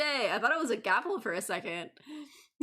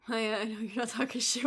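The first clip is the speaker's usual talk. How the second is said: ordinary talk, nothing marked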